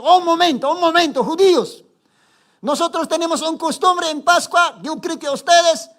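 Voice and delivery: high, loud proclamation to the crowd; Pilate proclaiming. Acted. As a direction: call the crowd to order like a governor. (Pilato proclamando en voz alta)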